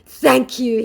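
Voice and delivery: high, loud sweet thanks; mock scammer voice. Vlogger mocking the scammer's thanks, high and loud. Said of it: "he says".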